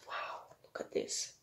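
breathless hushed wow; awed whisper. Real, not acted. Reader whispering to the child at the crash. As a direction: whisper it in wonder.

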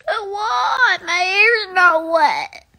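loud crying shout, slow. Real, not acted. Child in a crying breakdown over her hair. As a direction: wail it out in full breakdown. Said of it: "having a whole breakdown".

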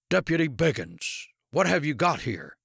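lightly acted imperious district attorney; demanding. A narrator's reading. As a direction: demand an answer from a deputy. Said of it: "Winchester demanded".

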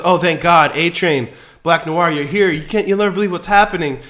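high, loud relieved rush; relieved cry. Retold or imitated. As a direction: gasp out relief, speeding up. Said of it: "And she goes".

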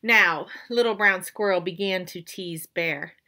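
usual speaking voice

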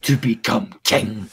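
slow, lightly put-on Scottish film-hero accent; mock a Scottish actor playing an Egyptian king, slow and grand. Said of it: "he spoke in his Scottish accent".

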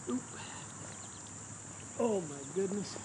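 normal talking voice

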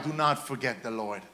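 ordinary delivery

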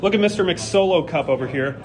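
ordinary delivery

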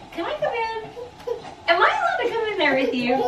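high excited doggy talk. Real, not acted. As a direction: squeal at the dogs. Greeting dogs after work.

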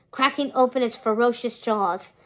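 plain reading voice

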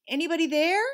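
high, loud call into the empty woods. A narrator's reading. Leon looking around for the voice, calling out loudly. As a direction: shout to find the voice.

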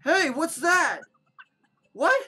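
high, loud startled call; alarmed. Acted. Townsperson spotting a monster, high startled shout. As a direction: call out on spotting something strange.

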